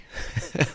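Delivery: short real chuckle; brief laugh at yourself; amused chuckle. Real, not acted. Host admitting he dreaded the hallway, amused chuckle.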